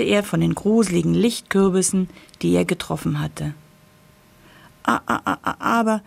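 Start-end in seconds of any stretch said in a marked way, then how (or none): 4.8-6.0 frightened stammer. A narrator's reading. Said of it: "der Kürbis war sehr erschrocken".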